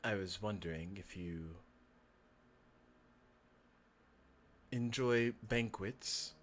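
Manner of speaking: low, hushed, halting speech; quiet, courteous, eerie stranger; creepy fox-man, tentative. Acted. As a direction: pause long and speak softly, feeling your way. Game master plays Kel.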